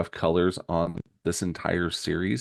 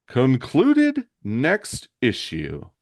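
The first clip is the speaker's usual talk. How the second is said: slow, raised cliffhanger announcement; announcer sign-off; 6 semitones higher, 3 dB louder, much slower than usual. A narrator's reading. Closing the comic read for Shane, dramatic announcer. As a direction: proclaim it grandly, stretching each word.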